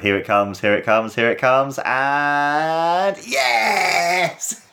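rising anticipation into a drawn-out yes; building excitement. Real, not acted. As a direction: build step by step, then let out a high cheer. Building up to the big moment.

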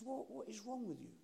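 lightly voiced baffled old man; mild disbelief. Gentleman questioning Mark's small request, mildly incredulous.

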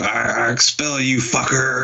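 high put-on voice for a slobbering threat; hammed-up drooling menace; drooling villain impression. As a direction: imitate someone spitting out a threat. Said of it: "Drooling everywhere".

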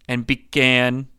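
plain reading voice

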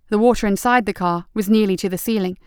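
ordinary delivery

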